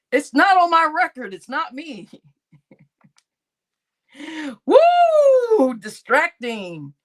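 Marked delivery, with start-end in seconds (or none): 0.1-1.3 more forceful, pitched up; emphatic repeat, louder; louder insistent. Real, not acted. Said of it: "It's not on my record, man". 4.7-5.7 high loud laughing whoop; loud joyful woo. Real, not acted. Celebratory whoop.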